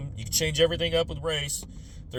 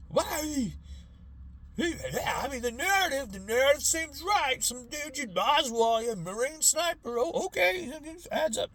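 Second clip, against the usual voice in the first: much higher, naive mock voice; gullible boomer; 9 semitones higher than usual. Boomers accepting the Oswald story, high and naive. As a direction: sound like someone swallowing the official story. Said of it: "and they're".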